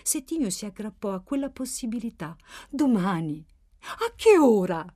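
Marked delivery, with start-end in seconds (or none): 2.7-4.9 loud, eager question; speranza ansiosa. A narrator's reading. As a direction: grab at the possibility, pressing for certainty. Anxious character grabs at hope. (domanda ansiosa e speranzosa, a voce alta)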